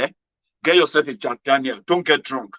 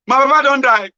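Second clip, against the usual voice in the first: wailing cry; 9 semitones higher, 7 dB louder than usual. Retold or imitated. Friend announces his father died.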